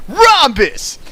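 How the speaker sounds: excited blurt. Acted. Sudden emphatic geometry word.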